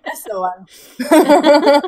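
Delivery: slow, laughing confirmation. Real, not acted. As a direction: laugh the words out. Laughing agreement.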